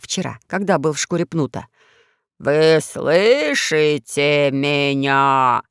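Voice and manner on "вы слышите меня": низкий хриплый, очень медленно; медленно, по слогам, хрипло; low hoarse voice. A narrator's reading. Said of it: "низким хриплым голосом". (низким хриплым голосом, по слогам)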